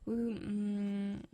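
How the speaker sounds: normal talking voice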